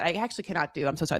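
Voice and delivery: normal talking voice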